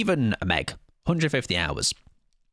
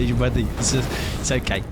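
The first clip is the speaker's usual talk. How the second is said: ordinary talk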